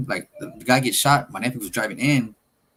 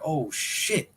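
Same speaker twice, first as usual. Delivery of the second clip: retold shock; 6 semitones higher than usual. Retold shock at flipped Jeep.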